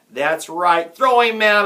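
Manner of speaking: loud, high screech of a heckler; woman's shriek. A narrator's reading. A woman jeers the player, shrieking. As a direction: shriek over the crowd. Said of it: "a woman shrieked".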